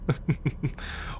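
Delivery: short, soft chuckle; quiet little snicker; taunting chuckle. Acted. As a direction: chuckle under your breath. Taunting the captive about mines.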